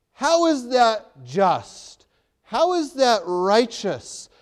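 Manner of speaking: high, loud, slow fiery sermon voice; impassioned preaching. Acted. Preacher challenging the congregation with Uriah's father's grief, fiery and loud. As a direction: plead the injustice at full force, slowly.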